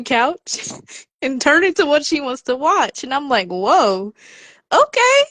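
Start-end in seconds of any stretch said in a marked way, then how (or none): 3.5-5.2 surprised. Real, not acted. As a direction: caught off guard, the okay leaps way up. Niece grabs the tablet herself.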